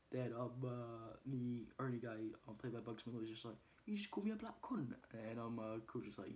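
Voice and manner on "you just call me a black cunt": high-pitched incredulous question; offended disbelief. Retold or imitated. Boxer answering Ernie's insult, offended disbelief. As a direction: imitate someone taken aback by an insult.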